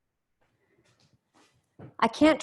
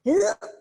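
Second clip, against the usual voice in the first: grunting body noise; 9 semitones higher, 6 dB louder than usual. Shown as a demonstration. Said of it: "my body goes".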